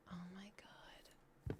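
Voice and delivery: breathy stunned whisper; unvoiced shocked hush. Real, not acted. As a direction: mutter it under your breath in disbelief. Whispered aside.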